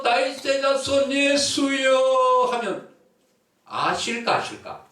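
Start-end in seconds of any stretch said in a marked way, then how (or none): 0.0-2.5 high, loud, very slow call; calling from afar. Acted. Woman calling out to Jesus from a distance, slow loud call. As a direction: stretch it out to reach someone far away. 멀리서.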